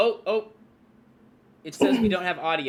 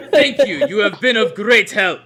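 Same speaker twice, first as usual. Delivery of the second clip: laughing; 3 semitones higher, 9 dB louder than usual. Acted. Character thanks bartender, laughing.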